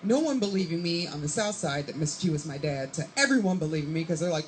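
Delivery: normal talking voice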